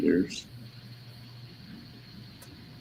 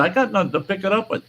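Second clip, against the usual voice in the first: a fast, higher mock neighbour voice; imitating the neighbour; 13 semitones higher, 3 dB quieter, faster than usual. The neighbour's excuse, quick mock voice. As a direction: make him sound helpless. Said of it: "He said".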